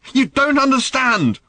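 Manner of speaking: high furious yell, slowed and drawn out; angry shout. Acted. Daughter screaming at her father in fury. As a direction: scream it at him in a teenage rage.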